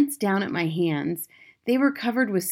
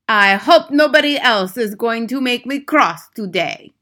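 put-on loud, higher accented warning; Grand High Witch; 4 semitones higher, 9 dB louder than usual. A narrator's reading. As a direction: warn the room with menace. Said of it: "she remarked".

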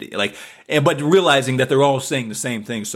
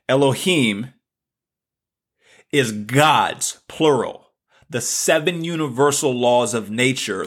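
ordinary talk, nothing marked

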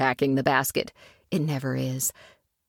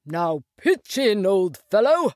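hearty Rat voice; 3 semitones higher than usual. A narrator's reading. Rat to Mole at lunch, hearty and inviting. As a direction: voice the Rat urging his friend to eat.

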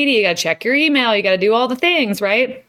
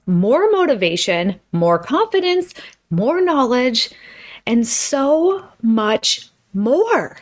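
"so much more" drawn out slowly with "more" leaned on; stress on MORE; slower than usual. Shown as a demonstration. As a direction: hammer the word "more" in every item of the list. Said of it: "Just emphasis on the word more".

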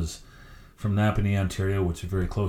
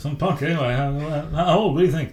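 higher, louder gruff hockey boss; a playful Don Cherry take, pitched up; 8 semitones higher, 4 dB louder than usual. Retold or imitated. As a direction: impersonate Don Cherry asking eagerly. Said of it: "Don looks at him and goes".

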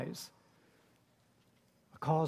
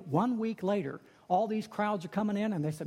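normal talking voice

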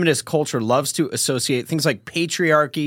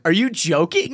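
imitated incredulous laughing question; higher, laughing in someone's face; mock disbelief through a laugh; Tucker Carlson; 4 semitones higher than usual. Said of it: "he was laughing in his face".

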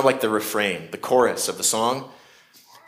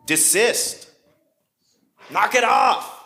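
rising, sharp commands climbing much higher; stern rebuke; 7 semitones higher, slower than usual. Shown as a demonstration. God rebuking those who oppose him, stern and rising. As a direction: stop them in their tracks. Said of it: "It's like".

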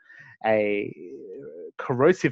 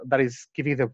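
normal talking voice

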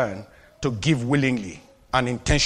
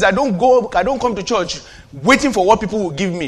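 ordinary talk

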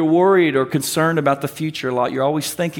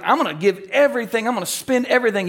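eager rush; 5 semitones higher, faster than usual. Retold or imitated. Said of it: "you're gonna be like".

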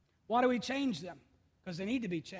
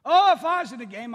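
mock couch coach; 4 semitones higher, 13 dB louder than usual. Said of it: "Do it all the time".

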